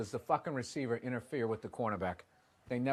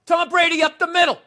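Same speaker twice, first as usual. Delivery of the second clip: excited play call; 14 semitones higher, 16 dB louder than usual. Real, not acted.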